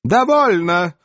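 sharp loud command. Acted. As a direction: bark it to stop the chatter. Elf leader commands silence.

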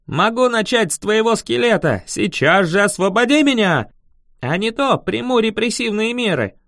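high, grandiose threat; villain act. A narrator's reading. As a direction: threaten like a hammed-up dark lord. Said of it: "Я вошёл в роль".

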